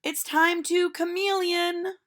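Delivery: put-on a cheerful teacher calling out to children; bright sing-song schoolteacher, slow and loud; silly teacher voice. Teacher speaks.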